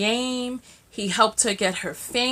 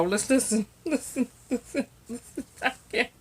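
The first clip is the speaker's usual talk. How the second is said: soft listen through laughter; quiet laughing listen; laughing hush; 2 semitones higher, 6 dB quieter than usual. Real, not acted. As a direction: giggle while hushing. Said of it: "laughing hushed listen".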